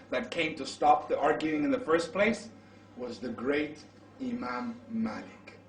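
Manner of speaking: normal talking voice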